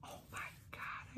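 breathless awed whisper. Real, not acted. Vlogger marvelling at a vintage card, whispered. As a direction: hush it out in wonder.

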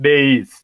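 "Days" as one firm falling word with a long AY; correct pronunciation. Shown as a demonstration. Trainer shows teachers how dais is read, correct pronunciation. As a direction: say "Days" with an AY vowel, not EYE. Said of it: "That is the pronunciation".

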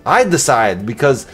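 amateur Austrian-accent impression of an action star; Arnold Schwarzenegger accent. Vlogger redoing a silent unboxing for viewers, mock Austrian accent. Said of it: "in an Arnold Schwarzenegger accent".

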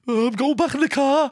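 high, squeaky sulky fiancee voice; hammed-up whiny storm-off; mock huffy girlfriend.

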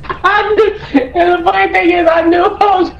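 high laughing telling; can barely get it out for laughing; giggling recounting. Real, not acted. Laughing retelling.

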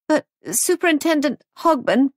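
high, urgent call after him; alarmed appeal. Acted. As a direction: catch him before he hangs up. Molly cut off on phone.

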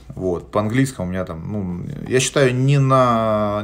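normal talking voice

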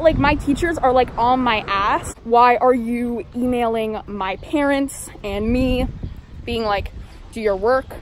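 muffled behind surgical mask; through a face mask. Real, not acted. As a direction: mouth covered by cloth. Seen 1: Blue surgical mask covers mouth and nose throughout; pulled down at -3.

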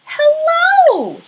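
a watchman startled to find a bear; surprised watchman. Acted. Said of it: "he said".

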